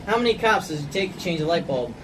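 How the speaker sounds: usual speaking voice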